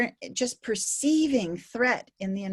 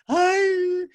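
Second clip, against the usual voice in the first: put-on upset child; 10 semitones higher, 6 dB louder than usual. Upset child from play yard.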